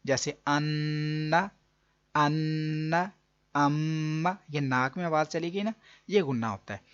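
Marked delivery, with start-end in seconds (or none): 0.1-4.4 अन्ना and अम्मा slow, with the न and म hummed through the nose; nasal ghunna demo. Shown as a demonstration. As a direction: hum the doubled न and म of अन्ना, अम्मा. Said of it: "नाक में आवाज़ ले जाना". (अन्ना, अम्मा - नाक में आवाज़ ले जाकर, धीरे)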